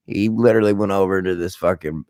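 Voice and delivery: ordinary talk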